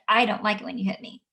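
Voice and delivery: ordinary talk, nothing marked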